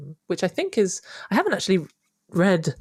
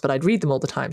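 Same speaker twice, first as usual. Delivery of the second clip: ordinary delivery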